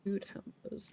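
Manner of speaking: breathy whisper of "Food hampers."; under-the-breath "Food hampers.". Real, not acted. Muttered repeat of the word.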